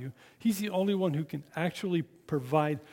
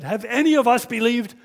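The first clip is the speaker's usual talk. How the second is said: high, loud sneer; indignant retort; 7 semitones higher, 10 dB louder than usual. Acted. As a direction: scorn the very idea. Pharisees scolding again.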